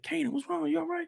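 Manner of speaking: soft, high-pitched check-in; concerned Jukebox. Acted. Jukebox coming back to check on Kanan, soft and high. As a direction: ask gently in a small, high voice. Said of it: "she probably come back in like".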